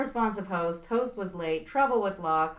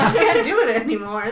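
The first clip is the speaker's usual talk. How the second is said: laughing; 10 dB louder than usual. Real, not acted. Laughing at options.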